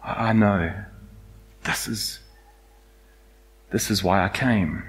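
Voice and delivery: soft, quiet, tender reassurance; calm a frightened friend; Jesus gently answers the kneeling Peter; gentle Jesus. Acted. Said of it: "Jesus in his head going".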